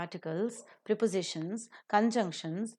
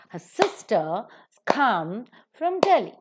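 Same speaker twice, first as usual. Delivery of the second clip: slow beats on "sister", "come", "Delhi", weak "has" and "from"; rhythmic stress; 4 dB louder, slower than usual. Shown as a demonstration. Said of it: "that's how you maintain the rhythm".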